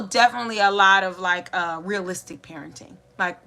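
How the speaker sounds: normal talking voice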